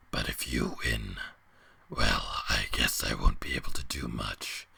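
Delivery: a weary giant creature rumbling from the dark; ancient beast. A narrator's reading. Beast explains the dome.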